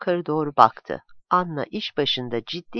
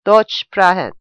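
a curt, rude official on the line, louder; reply roughly in German, with more force; gruff telephone voice; 8 dB louder than usual. A narrator's reading. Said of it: "telefondaki ses kabaca". (kaba bir telefon sesi)

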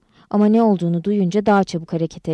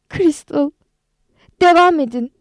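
loud, high urgent call; shouting; 7 semitones higher, 5 dB louder than usual. A narrator's reading. Calling to Crystal to keep the melody going, shouting. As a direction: shout the order out, pitch lifted. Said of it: "diye bağırdı".

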